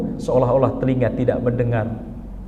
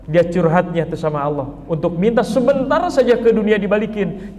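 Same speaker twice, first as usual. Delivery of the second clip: ordinary talk, nothing marked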